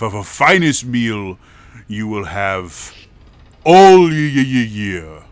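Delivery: booming openings, slowed emphatic phrases; hearty father host. Acted. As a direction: welcome guests grandly to your table. Game master plays the father host.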